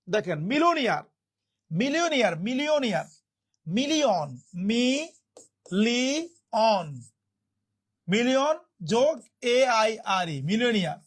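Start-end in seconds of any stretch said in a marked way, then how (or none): 4.5-7.0 মি-লি-অন very slow, three separate drawn-out syllables. Shown as a demonstration. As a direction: stretch and separate each part of মি-লি-অন. Teacher breaks word into syllables.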